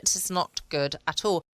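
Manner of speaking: ordinary delivery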